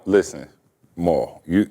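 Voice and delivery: ordinary talk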